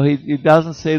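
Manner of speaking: ordinary talk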